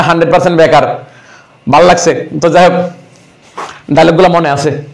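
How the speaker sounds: ordinary talk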